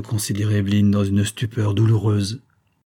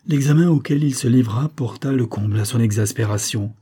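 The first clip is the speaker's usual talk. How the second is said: ordinary delivery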